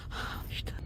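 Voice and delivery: whisper. Acted. Hiding from hunters.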